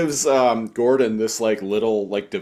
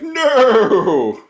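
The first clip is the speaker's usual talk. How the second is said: mock shout; 11 semitones higher, 5 dB louder than usual. Character's outburst in retold scene.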